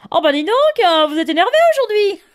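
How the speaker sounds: high, loud, fast teasing remark; amused nurse. Retold or imitated. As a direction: tease her for being worked up. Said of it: "elle me fait".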